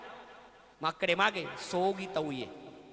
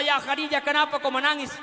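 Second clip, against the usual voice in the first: voicing the Prophet; 6 semitones higher, 6 dB louder than usual. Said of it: "Kata Rasulullah".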